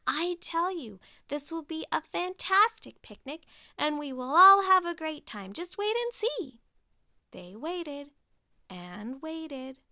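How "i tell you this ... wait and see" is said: higher and brighter insistence; cheery Pig. Acted. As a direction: promise it with gusto.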